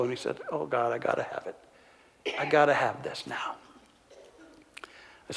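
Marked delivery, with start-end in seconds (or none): 0.5-3.6 softer, broken plea; tearful plea. Retold or imitated. Ron weeping in the office, softly begging for help. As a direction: let the tears cut the voice down to a hush. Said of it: "the tears began to flow".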